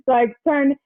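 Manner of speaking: usual speaking voice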